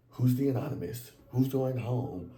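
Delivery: ordinary delivery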